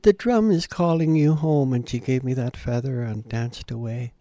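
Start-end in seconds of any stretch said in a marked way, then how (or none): 0.0-1.7 put-on old lady repeating. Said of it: "She said".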